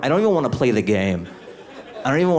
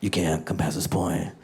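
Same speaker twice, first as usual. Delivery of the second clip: somber cop; 5 semitones lower, 5 dB quieter than usual. Retold or imitated. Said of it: "they're like".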